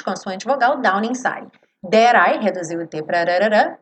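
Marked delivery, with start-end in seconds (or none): 0.8-1.5 down inside joined as "dawninside"; "down inside" linked; linked consonant. Shown as a demonstration. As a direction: run the N of down into inside. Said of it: "down inside vai ligar naturalmente".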